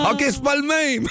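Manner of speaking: laughing. Real, not acted. Laughing at the Martin mix-up.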